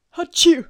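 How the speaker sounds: loud sudden sneeze; big explosive sneeze; sneezing. Real, not acted. Outtakes full of sneezes.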